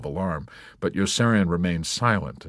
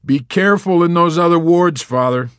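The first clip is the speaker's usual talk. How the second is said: high, loud warning; urgent warning; 11 semitones higher, 9 dB louder than usual. A narrator's reading. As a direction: warn him loudly and urgently. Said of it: "Yossarian warned".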